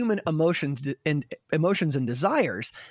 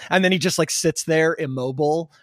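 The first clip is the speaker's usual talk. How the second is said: ordinary talk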